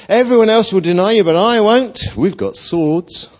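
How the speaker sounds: loud, high, hot-headed promise; bold vow. Acted. Simon swears loyalty to Jesus, bold and hot-headed. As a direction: rush in boldly, then stretch the final boast. Simon vows he will never deny.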